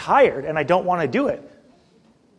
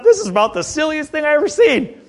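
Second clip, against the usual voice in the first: scoffing disbelief; 9 semitones higher, 3 dB louder than usual. Retold or imitated. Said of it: "I thought".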